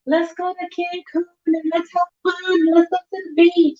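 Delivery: high, giddy squeal of mock enthusiasm; imitating someone on a manic high; mock manic excitement. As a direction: gush it way up high, hammed-up.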